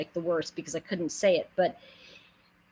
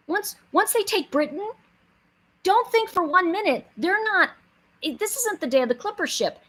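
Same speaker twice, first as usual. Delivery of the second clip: higher and louder, full of attitude; 6 semitones higher, 5 dB louder than usual. Retold or imitated. Retelling Roosevelt's war warning to listeners, higher, louder, with attitude. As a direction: hammer the warning home with sass and force. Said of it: "But he basically said".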